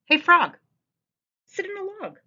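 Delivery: high, bossy cat voice; a pushy cat bossing the frog; cat character voice. Acted. Teacher reading the cat to kids, high and bossy. Reads the cat's lines aloud.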